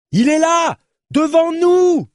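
high, loud, drawn-out yell; full-lung shout. Acted. Journalist to exhausted companion, sighting the boat, full-lung shout. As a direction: bellow it ahead with all your breath. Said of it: "s'époumona Lilio".

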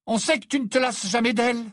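high, loud and accusing; annoyed retort. Acted. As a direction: throw the jibe back sharply. Ginny's angry retort to Ron.

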